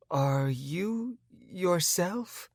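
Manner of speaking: very slow, hesitant asking; tentative, not sure who answers; wary girlfriend checking on Sam; cautious question. A narrator's reading. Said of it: "approaching me cautiously".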